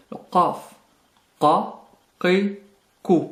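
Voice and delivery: "qa, qi, ku" with a heavy k far back in the throat; emphatic Q. Shown as a demonstration. Teacher introducing an emphatic letter, heavy back Q. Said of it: "Une nouvelle lettre emphatisée".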